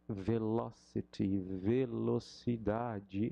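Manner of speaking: velocity and velocidade drawn out slowly; slow comparison. Shown as a demonstration. Teacher comparing velocity with velocidade slowly. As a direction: stretch both words to show the likeness. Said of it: "é muito mais cômodo".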